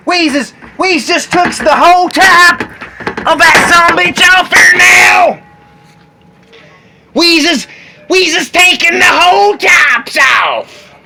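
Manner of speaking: high, loud slow cheer; excited cheering. Real, not acted. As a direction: whoop at the win.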